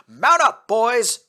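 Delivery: high, loud rallying yell. Acted. Cory yelling to his troops before the assault. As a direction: shout the troops into motion. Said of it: "Cory yelled".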